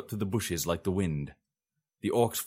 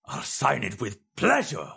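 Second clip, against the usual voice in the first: snarling; 5 semitones higher than usual. A narrator's reading. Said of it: "the Emperor snarled".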